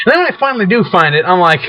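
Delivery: ordinary talk, nothing marked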